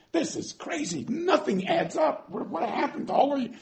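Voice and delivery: high, soft, incredulous; bewildered. Acted. Gideon wondering where the miracles went, high and bewildered. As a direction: ask it high in disbelief.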